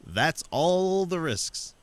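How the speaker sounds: high, loud, slow sneer; sarcastic mockery. Mocking Glenn on air, high slow sarcasm. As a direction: drag it out to mock him.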